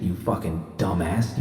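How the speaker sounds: usual speaking voice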